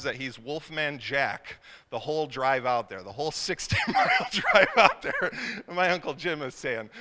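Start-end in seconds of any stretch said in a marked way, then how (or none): 3.1-5.3 high, loud laughter breaking into words; laughing. Real, not acted. Recalling dad's antics on the drive, laughing. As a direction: crack up while telling it. Cracks up at own family story.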